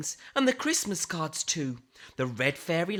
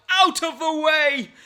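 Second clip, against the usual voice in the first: high, loud shout clearing the path; yell; 10 semitones higher, 8 dB louder than usual. Acted. Father Christmas shouting as he flies out on his sleigh, loud yell. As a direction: bellow it to clear the way. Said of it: "Father Christmas yelled".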